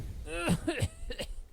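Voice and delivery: acted spluttering cough; coughing up water. A narrator's reading. Rescued sailor coughs up seawater, spluttering. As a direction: cough as if clearing water from the lungs. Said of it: "He coughed up a lungful of water".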